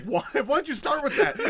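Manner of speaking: high, incredulous comic outburst; mock-exasperated yelp. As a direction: cry out in disbelief, half laughing. Acted Gandalf exasperated at Pippin.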